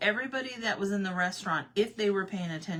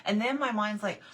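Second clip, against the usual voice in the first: ordinary talk, nothing marked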